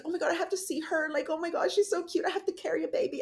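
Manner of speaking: high, quick, imitated gush over a baby; excited gushing. Retelling a woman cooing to see the new baby, excited gushing.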